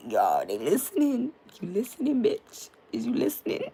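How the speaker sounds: loud, high-pitched playful challenge; mock confrontation. Streamer taunts the agents supposedly listening in, mock confrontational. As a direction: call out the eavesdroppers, pitch leaping up. Mock calling out phone eavesdroppers.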